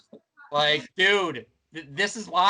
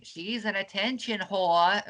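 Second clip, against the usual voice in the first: mock British accent, higher and drawn out; British-accented impression of her, pitched up; 5 semitones higher, slower than usual. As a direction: imitate her in a slow, put-on British accent. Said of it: "That's my British accent".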